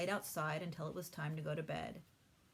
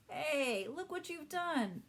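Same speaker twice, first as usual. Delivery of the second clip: high, slow, alarmed cry; dismayed exclamation; 9 semitones higher, 5 dB louder, much slower than usual. A narrator's reading. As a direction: react to the accident in a flash. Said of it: "exclaimed Pete".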